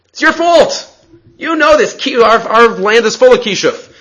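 sellers blaming him. Retold or imitated. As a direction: throw the blame back at him, sharply. Sellers answering Zeiri.

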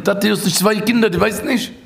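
high-pitched mock weeping appeal; acted tearful plea. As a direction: plead with father as if near tears. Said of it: "ich muss zu weinen". (געשפילט וויינענדיג בעטן, מיט א הויך קול)

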